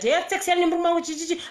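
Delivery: high, fast and excited. Real, not acted. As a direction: rattle it off giddily. Excited aside, laughing.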